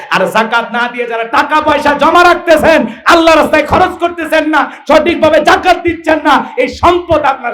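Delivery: high, insistent sermon warning; full-force preaching. Acted. Preacher warns those withholding zakat, high and insistent. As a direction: drive each clause home to the crowd.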